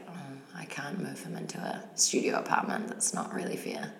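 soft, almost whispered reasoning; quiet regret. Retold or imitated. Deciding not to move her cat, soft and hushed. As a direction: murmur it to yourself, resigned. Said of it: "I was like".

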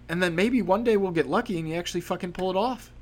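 normal talking voice

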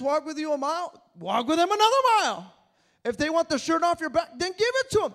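hammering the congregation with the refrain; climactic preaching. Acted. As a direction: pound the refrain like a sermon peak.

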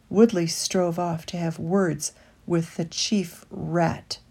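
ordinary delivery

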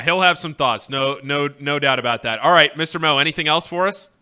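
usual speaking voice

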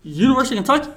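high, loud, quick teasing probe; spring the trap with fake curiosity; gleeful mock interrogation; mock gotcha question. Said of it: "go back and be like".

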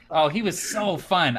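ordinary talk, nothing marked

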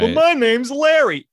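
high, loud and slow protest; a dim juror pleading for his name; squeaky drawn-out whine; whiny juror. Pretend.

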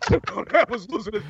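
real laugh running through the words. Real, not acted. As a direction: repeat it through helpless giggles. Repeats the line while cracking up.

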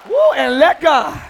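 preacher's call to let go; loud call and response. Shown as a demonstration. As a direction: get them clapping and shouting. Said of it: "Clap your hands and say".

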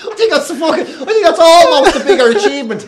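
high, loud through laughter; helpless laughter breaking into the words; real laughing. Real, not acted. Comedian joking about his unseen series, laughing hard.

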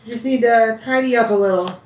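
a high, homely country mother; mother's voice. Acted. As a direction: call out kindly from the kitchen sink. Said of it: "you kinda hear her like".

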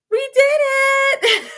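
very high, loud victory cry; triumphant shout. Retold or imitated. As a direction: cheer it out in triumph. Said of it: "and we're like".

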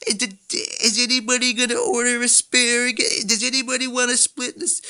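high, eager prying questions; high-pitched mock of a friend who has to know your order; nosy dinner companion. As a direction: pester them about the menu. Mimicking nosy dining companions.

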